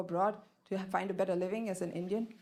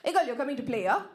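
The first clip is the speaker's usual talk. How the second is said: put-on Tamil-accented English, pitched up; 4 semitones higher, 3 dB louder, much faster than usual. Schoolgirl asking a friend out to play, in a Tamil accent. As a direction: invite them in a Tamil accent. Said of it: "I was talking like this".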